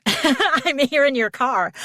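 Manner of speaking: laughing through the words. Retold or imitated. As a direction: giggle out the punchline. Joking she was already in the car.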